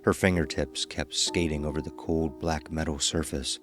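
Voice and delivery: usual speaking voice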